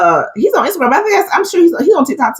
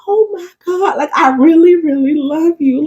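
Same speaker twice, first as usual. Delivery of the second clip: gushing fan; 9 semitones higher than usual. Retold or imitated. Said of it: "I would just be like".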